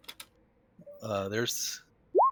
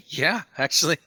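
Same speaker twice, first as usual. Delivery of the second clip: higher, gleeful in-character acceptance; delighted agreement; 4 semitones higher, 4 dB louder than usual. Acted. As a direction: give in happily to the tempting offer. Player tempted by bagpiper promise.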